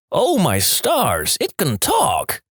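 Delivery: high, slow stunned gasp; oh my stars, drawn out high and slow; shocked exclaim. Acted.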